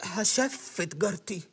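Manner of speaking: dazed slow repeat. Acted. Guest repeats slowly, dazed.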